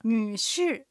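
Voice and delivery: 女士 in Mandarin, nǚshì with 女 dipping and rising in the third tone and 士 falling in the fourth; teacher models 女士 slowly and clearly; Chinese drill word. Shown as a demonstration. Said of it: "もう一度おさらいしましょう。". (女士を中国語で、女は三声で下がって上がり、士は四声で下げる)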